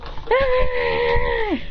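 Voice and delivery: very high sustained "ah"; long high mock wail; acted cry. Character shocked, acted cry.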